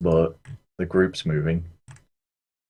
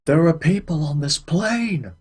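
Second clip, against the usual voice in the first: alarmed cry; 9 semitones higher, 3 dB louder than usual. Pretend. Alarmed shout about passengers.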